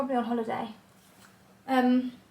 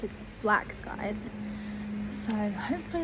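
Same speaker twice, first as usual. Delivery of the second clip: slow whispered aside; 2 semitones lower, 9 dB quieter than usual. Real, not acted. Looking back at the dark clouds, whispered. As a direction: breathe it out ominously.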